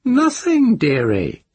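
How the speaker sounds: put-on high, cracked old crone gossiping; nosy old village witch. A narrator's reading. The witch speaks.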